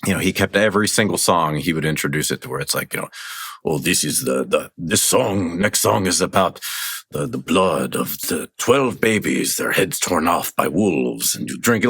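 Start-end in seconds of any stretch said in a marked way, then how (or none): 3.6-11.9 a hint of a Hungarian vampire count introducing songs; Hungarian accent. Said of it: "that sort of Hungarian accent".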